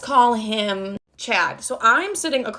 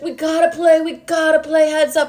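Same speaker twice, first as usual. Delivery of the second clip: excited high mom; 7 semitones higher, 4 dB louder than usual. Retold or imitated. High mom begging to play.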